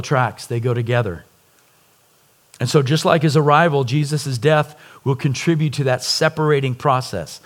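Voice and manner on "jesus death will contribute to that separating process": normal talking voice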